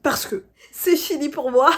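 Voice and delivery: high, loud cry breaking into laughter; loud mock despair dissolving into a laugh; laughing outburst. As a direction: exclaim it, then burst out laughing. Jokes she is done for, laughs.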